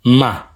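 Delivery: Ma sung out on a high level pitch. Shown as a demonstration. Teacher models a high tone for learners: Ma high. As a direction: hold Ma up high, same loudness.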